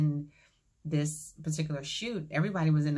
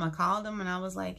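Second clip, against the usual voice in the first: normal talking voice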